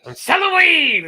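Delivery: high, loud holler; hammed-up shouted quote; comedic yell. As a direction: yell it out like a street hawker. Acting out Chappelle bit's street baby.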